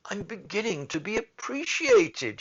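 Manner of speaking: high, loud delighted cry; cried out. Acted. The poor student cries out in delight at the sapphire. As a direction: burst out with sudden joy. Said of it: "he cried".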